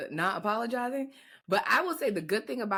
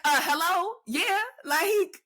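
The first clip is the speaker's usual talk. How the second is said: high, indignant mock disbelief; acted-out incredulous "duh" tone; exasperated retort; 10 semitones higher, slower than usual. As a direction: call out the obvious, pitched way up. Retells indignant reply to oblivious partner.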